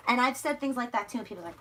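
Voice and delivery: ordinary talk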